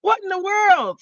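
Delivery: high, incredulous what in the world; mock disbelief at the movie choice; exasperated dude. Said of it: "And I'm like".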